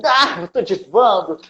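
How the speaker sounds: high, showy diva voice with a laugh; mock diva. Showing off to the world outside in a mock high diva voice. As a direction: ham up the fabulous diva, laughing. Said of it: "por fora a gente tá assim".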